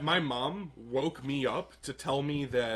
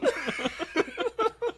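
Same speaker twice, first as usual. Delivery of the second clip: high, long burst of laughter. Real, not acted. Friends on the podcast hear the Obama mixup, laughing hard. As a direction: lose it laughing. Cracking up at own story.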